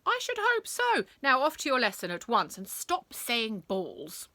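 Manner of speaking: high, loud headmistress's telling-off; indignant dismissal; scolding. A narrator's reading. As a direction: scold sternly, starting pitched right up.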